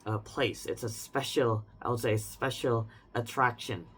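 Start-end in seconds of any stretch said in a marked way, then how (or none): none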